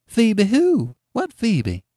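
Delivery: high-pitched, puzzled woman's voice; curious country woman. A narrator's reading. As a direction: ask high, with baffled curiosity. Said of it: "replied Missus Race, curious".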